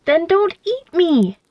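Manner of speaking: high, slow shouted plea. Acted. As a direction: fling it back, high and drawn out. Mouse shouts back at owl.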